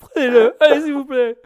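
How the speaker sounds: high whiny begging cry; mock pleading. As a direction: beg like you're desperate. Pleading jokingly for listeners.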